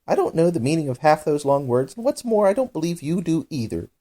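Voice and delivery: a pert eaglet cutting down big words; cheeky eaglet. A narrator's reading. Eaglet scolds the dodo.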